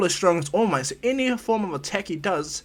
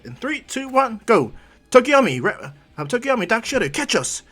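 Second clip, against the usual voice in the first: raised, high team-leader call; urgent call; 8 semitones higher, 4 dB louder than usual. Acted. Izuku calling teammates to catch them, urgently. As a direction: call out commands to teammates mid-action.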